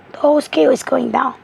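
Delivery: ordinary delivery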